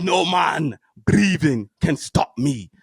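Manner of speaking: slow, deliberate mock African accent; wrestler's promo in a mock African accent; imitating an African accent. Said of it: "with that crazy African accent".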